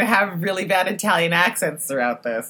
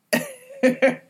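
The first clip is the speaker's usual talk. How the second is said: high squealing laugh; 5 dB louder than usual. Real, not acted. Reacting to show.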